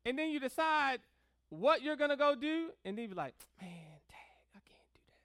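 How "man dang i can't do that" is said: voiceless, very soft grumble; deflated whisper. Acted. Preacher acting out someone held back from misbehaving, deflated whisper. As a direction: whisper it in resigned defeat.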